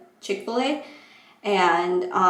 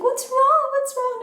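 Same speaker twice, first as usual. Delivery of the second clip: much higher squeaky worried voice; high mock worry. Friends asking what's wrong, high squeaky worry. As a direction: fret it in a tiny high voice. Sister and friend fussing over her.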